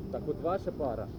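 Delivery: usual speaking voice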